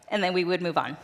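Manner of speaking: ordinary delivery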